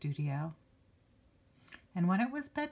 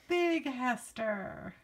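higher and slow, drawn out grandly; big reveal; 6 semitones higher, much slower than usual. A narrator's reading. Reader announcing grown-up Hester, high and slow. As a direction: land the big reveal, stretched out. Climax of the growing build-up.

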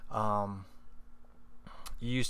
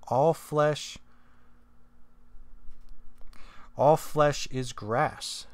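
slow, raised preaching cry; proclaiming scripture; 5 semitones higher, much slower than usual. Acted. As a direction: proclaim it like a prophet from the pulpit. Dramatic scripture reading, then reread.

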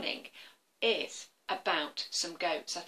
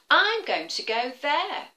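high, slow little goat; lightly voiced small goat; 6 semitones higher, 5 dB louder than usual. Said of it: "he said".

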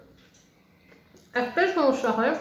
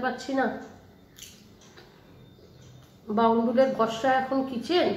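speaking with some food; slightly muffled while eating; talking after a bite. Real, not acted. Seen 1: Bite at -2s; she then talks at the plate, mouth likely not empty.

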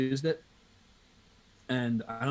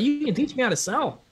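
mock naive; 12 semitones higher, 4 dB louder than usual. [name] plays dumb with rep.

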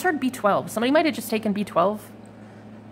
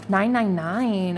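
slow, drawn out; much slower than usual. Real, not acted. Reading angel number slowly.

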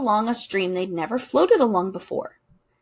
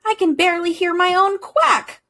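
yell over waves; 8 semitones higher, 5 dB louder than usual. A narrator's reading. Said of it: "yelled Duck".

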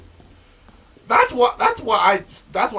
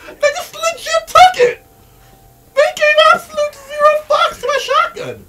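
high, loud, half-laughing, half-crying rant; laughing outrage; 7 dB louder than usual. Real, not acted. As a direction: cackle in disbelief while complaining. Disbelief at zombies tanking shotgun.

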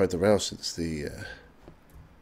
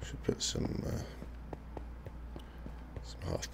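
whisper; 15 dB quieter, slower than usual. Real, not acted. Muttered aside.

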